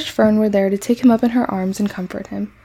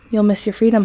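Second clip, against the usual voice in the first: ordinary delivery, nothing marked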